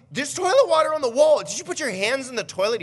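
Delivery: high, loud mock interrogation, easing slightly; exasperated parent. Dad confronting his toddler over the toilet, exasperated. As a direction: re-enact a dad's incredulous telling-off.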